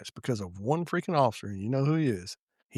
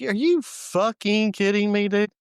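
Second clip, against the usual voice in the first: exasperated disbelief; 8 semitones higher, 5 dB louder, slower than usual. Retold or imitated. Retold disbelief at officer's news.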